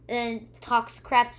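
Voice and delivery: ordinary talk, nothing marked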